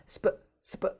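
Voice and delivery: 스파 as "spuh", short dull vowel; reduced vowel. Shown as a demonstration. Teacher demonstrates the English sound, 스파 as "spuh". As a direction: say 스파 between 어 and 으. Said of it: "이런 소리가 납니다".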